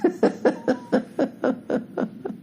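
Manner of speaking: long, high string of laughs; giggling fit. Real, not acted. Comedian cracking up at his lyric, high laughter. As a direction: crack up helplessly.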